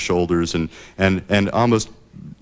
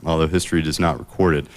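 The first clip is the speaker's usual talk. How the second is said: ordinary talk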